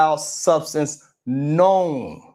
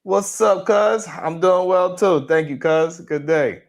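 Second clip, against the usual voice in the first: ordinary talk, nothing marked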